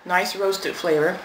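chewing while speaking; talking between bites; mouth full. Real, not acted. Seen 1: Lips pursed and chewing after a forkful, then speaking from 2.5s.

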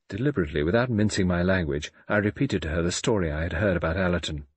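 usual speaking voice

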